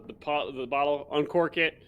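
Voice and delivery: ordinary talk, nothing marked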